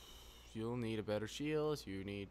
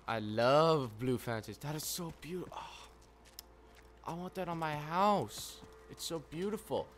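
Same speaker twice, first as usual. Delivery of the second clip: mock gushing; 5 semitones higher than usual. A player mock-swooning over a blue fence, high and sugary. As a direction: coo at the fence like it's a treasure.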